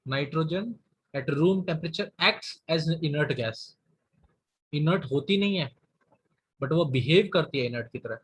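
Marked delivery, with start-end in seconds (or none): 0.1-3.6 slow, deliberate repeat for emphasis. Shown as a demonstration. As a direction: repeat it slowly so it sticks. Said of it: "फिर से बता रहा हूँ". (धीरे-धीरे दोहराते हुए)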